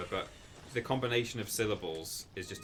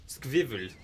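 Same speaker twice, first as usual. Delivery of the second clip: put-on squirrel mangled with a German accent; 4 semitones higher, 5 dB louder than usual. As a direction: say squirrel like a struggling German speaker. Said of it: "trying to get a German to say".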